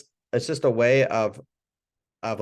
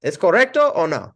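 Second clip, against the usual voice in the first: higher, louder polite check; checking question; 6 semitones higher, 7 dB louder than usual. Shown as a demonstration. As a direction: ask if you got it right. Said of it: "And then you say".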